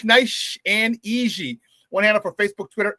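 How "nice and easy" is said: "Nice and easy" stretched very slowly; drawn out. Shown as a demonstration. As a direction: savour each word, slow and smooth.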